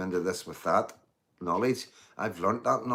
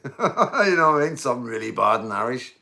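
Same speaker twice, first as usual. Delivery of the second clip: laughing; 4 semitones higher, 8 dB louder than usual. Real, not acted. Laughing at own Irish attempt.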